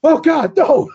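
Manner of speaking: very high, loud mock cry with a laugh; hammed-up panic squeal; mock horror. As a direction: ham up the horror, cracking into a laugh. Acted horror in retold scene.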